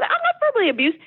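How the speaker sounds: a retold indignant denial, pitched well up. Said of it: "And I said".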